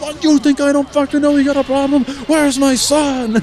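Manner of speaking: high, loud angry retort, slowing at the end; furious farmer. Acted. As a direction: snap back at the ranch hand. Farmer snaps at ranch hand.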